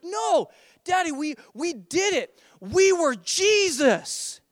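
put-on slow, high little-boy insistence; beaming child. Son correcting dad in the car, triumphant. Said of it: "with this beaming, glowing face".

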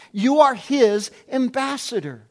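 normal talking voice

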